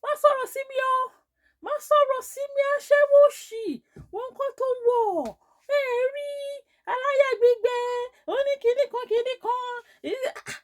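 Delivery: very high, loud screeched tirade; retold screaming. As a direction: screech abuse like an enraged market seller. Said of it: "She started screaming at me".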